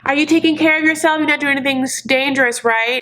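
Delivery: high, rushed, fussing mom voice; creepy doting mom. Retold or imitated. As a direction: imitate an over-anxious mother checking up. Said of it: "her mom relationship is so creepy".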